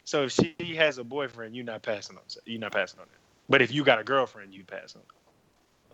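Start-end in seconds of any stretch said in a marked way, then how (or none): 4.5-5.9 soft, slow mumble, barely voiced; trailing off into a mumble; mumbled. Real, not acted. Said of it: "I didn't understand how you said it".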